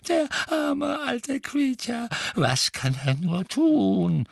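put-on high, drawn-out wail of an old servant; lamenting old house-elf. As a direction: moan and mutter to yourself, stretching the words. (klagender alter Hauself)

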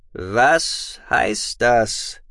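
very slow, heavy demand; booming voice. Acted. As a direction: boom out each word. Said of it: "jetzt mit dröhnender Stimme". (mit dröhnender Stimme)

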